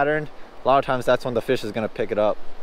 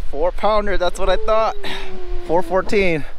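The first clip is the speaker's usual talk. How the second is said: excited; 7 semitones higher, 3 dB louder than usual. Real, not acted. Reads scale, thrilled.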